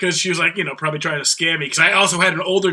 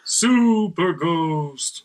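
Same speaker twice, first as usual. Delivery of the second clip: Super Ghost, very slow and deliberate; slow careful Super Ghost; 4 dB quieter, much slower than usual. Shown as a demonstration. Friends on a podcast, trying the tongue twister slowly. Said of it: "I can say that five times fast".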